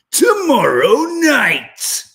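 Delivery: high, slow, drawn-out reality TV announcer; Love Island voiceover. Retold or imitated. Quoting the Love Island announcer in a high, slow voice. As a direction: stretch it like the show's narrator. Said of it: "as they say on Love Island".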